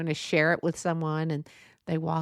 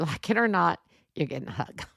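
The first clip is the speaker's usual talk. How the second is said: ordinary talk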